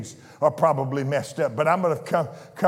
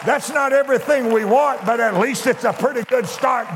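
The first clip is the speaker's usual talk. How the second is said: rising preaching; 6 semitones higher, 3 dB louder than usual. Acted. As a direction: pitch climbing into preaching mode, higher. Preacher warming up.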